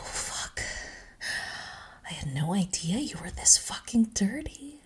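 ordinary delivery

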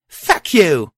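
loud high shouted curse. Acted. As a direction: yell it out with force. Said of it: "I shouted".

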